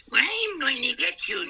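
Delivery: high, slow cackling witch threat; evil witch voice. Retold or imitated. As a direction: menace them like a storybook witch. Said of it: "the evil witch said".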